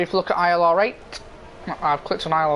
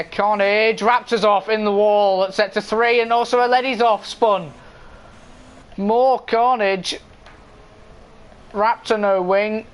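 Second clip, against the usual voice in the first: motorsport commentator; 3 semitones higher, slower than usual. Real, not acted. Live race play-by-play.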